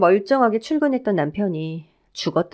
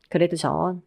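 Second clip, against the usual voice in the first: plain reading voice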